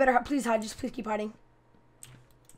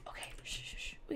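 hushed whisper; 28 dB quieter, faster than usual. Real, not acted. Gamer sneaking toward an enemy, whispering shh. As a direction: whisper the shushes, barely any voice.

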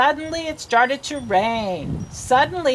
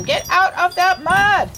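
raised, higher scolding call without a full scream; mock scream; 6 semitones higher than usual. A narrator's reading. Teacher voices Missus Wishy-Washy at the muddy animals, mock scream. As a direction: play at screaming an order. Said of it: "Missus Wishy-Washy screamed at them".

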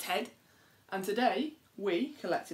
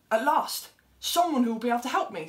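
high, loud, hopeful rush; the mole hurrying to the flies for help; relieved and excited; 4 semitones higher, 6 dB louder than usual. Acted. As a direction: burst out with relief at last. Mole finally finds the flies.

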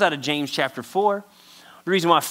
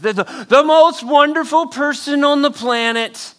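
higher and louder, gushing over someone; gushing mock adoration; 8 semitones higher, 5 dB louder than usual. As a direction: overplay the fawning fan. Crowd adoring you, imagined.

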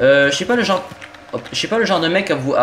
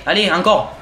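ordinary talk

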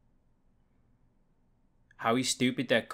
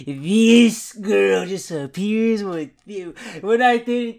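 slow, slightly higher put-on sulky voice; moody girl voice; 6 semitones higher, 5 dB louder, much slower than usual. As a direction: drag it out like she's fed up. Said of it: "and then she's like".

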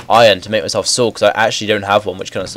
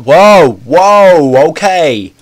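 startled shout; 7 semitones higher, 8 dB louder, much slower than usual. Real, not acted. Startled by a sudden event.

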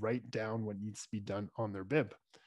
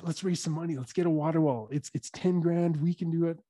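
eager rallying; 5 semitones higher, 4 dB louder than usual. Retold or imitated. Rallying buddies to fundraise.

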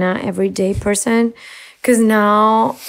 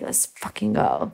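urgent whisper; 10 dB quieter than usual. Retold or imitated. Ex urges her to leave.

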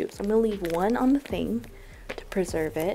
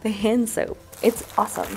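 ordinary talk

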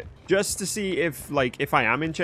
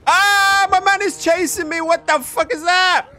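high, loud startled shout; startled yell; 7 dB louder than usual. Real, not acted. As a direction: yelp in shock at the killer. Startled by a killer chasing him.

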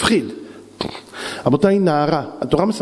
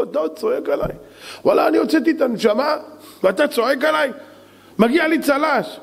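high-pitched, aggrieved comeback; hurt, high-voiced indignation; indignant protest; 11 semitones higher than usual. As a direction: protest the scolding as deeply unfair. Acted rescuer indignant at being scolded.

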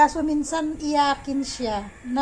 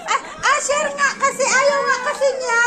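much higher, strained mother's protest; exasperated scolding; 9 semitones higher, 3 dB louder than usual. Real, not acted. Mother to Asher, baby doesn't want it, exasperated.

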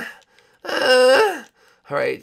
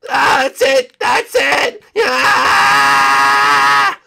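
loud higher straining cry; battle yell; 6 semitones higher, 9 dB louder, much slower than usual. Acted. Fighting the queen, loud straining yell.

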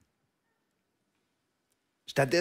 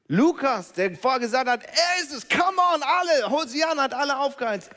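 much higher and louder, fired up; hyped up; 10 semitones higher, 8 dB louder than usual. Acted. Lukas hypes the crowd with Hosianna, high and loud. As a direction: whip the crowd up like a hype man.

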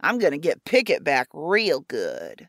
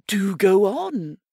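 slow, louder, sly invitation; sly rabbit Pickett; 2 semitones higher, 5 dB louder, slower than usual. Acted. Pickett leaning on the fence with a sly grin, sly voice.

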